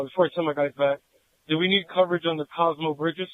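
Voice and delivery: ordinary talk, nothing marked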